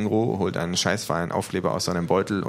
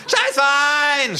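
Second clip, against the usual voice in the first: long, very high, loud yell; kangaroo scream; 8 dB louder, much slower than usual. Acted. Kangaroo joining in, drawn-out scream. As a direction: scream it out, stretching the word. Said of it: "schreien das Känguru".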